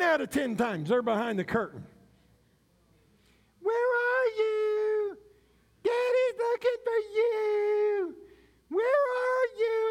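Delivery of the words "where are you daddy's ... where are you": much higher, slow sing-song search call; playful daddy calling. Pretend. Father calling to his hidden kids, high sing-song. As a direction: play the seeking daddy calling out. Father playing hide and seek.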